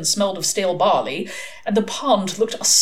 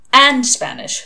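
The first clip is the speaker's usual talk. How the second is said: firmly insistent, higher and louder; 6 semitones higher, 6 dB louder, slower than usual. A narrator's reading. Adam correcting his friends on the Inquisition, firm. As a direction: put your foot down, mildly. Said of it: "said Adam firmly".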